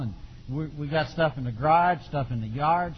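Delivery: ordinary talk, nothing marked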